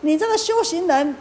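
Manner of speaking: stern spirit catching a thief; scolding. Acted. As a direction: scold the monk sharply from above. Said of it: "这个莲花神就骂他".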